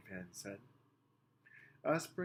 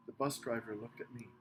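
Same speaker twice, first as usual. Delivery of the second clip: plain reading voice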